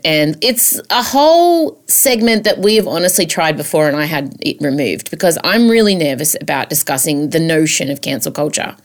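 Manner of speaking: ordinary delivery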